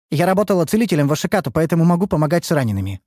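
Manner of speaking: fast, eager to prove herself; hurried. Acted. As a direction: rattle it off quickly. Лейна быстро предлагает помощь. (торопливо)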